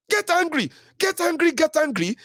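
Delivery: high, emphatic repeated urging; high-pitched emphatic call to action; rallying cry. Real, not acted. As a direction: pump up the crowd to get angry.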